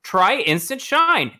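ordinary talk

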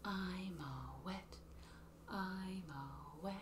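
whispered raindrops verse. Shown as a demonstration. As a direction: hush down to a whisper.

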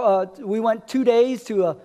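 normal talking voice

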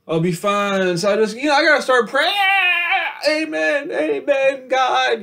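fake-cry a plea to God; mock crying. Vlogger mock-sobs to God about praying, wailing. Mock sobbing prayer, rising wail.

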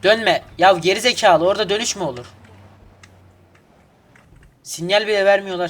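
furious shout at a dumb driver; angry yell. Real, not acted. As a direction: yell at the car cutting in. Yells at AI drivers.

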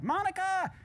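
a high, loud call across the office; mock yell. Mentor calls his assistant, sitcom yell. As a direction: shout the name like a sitcom boss. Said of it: "So he yells".

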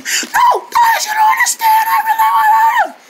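extremely high squealed words; words lost in a high shriek; squeaky squirrel shriek. Acted. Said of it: "She sounds like an empty squirrel".

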